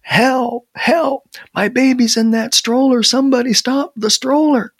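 high, urgent cries of a panicked father; yelling for help. A narrator's reading. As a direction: call out desperately to anyone around. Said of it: "He just yelled anyway".

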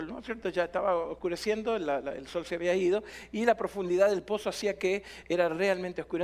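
ordinary delivery

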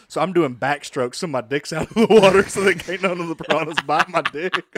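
laughing at his own story. Real, not acted. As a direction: crack up while telling it. Laughs while telling the story.

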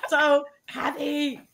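slow, high, put-on cartoon cat voice; Stimpy. Imitating Stimpy while scrubbing floors, slow and high. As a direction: ham up a giddy cartoon cat. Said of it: "I was like, Stimpy".

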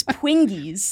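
"Pwingies" with a sounded G after the ng; drawn-out "Pwingies" with a clear hard G. Shown as a demonstration. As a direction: say "Pwingies" slowly, putting the G in it. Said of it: "gotta get the G in it".

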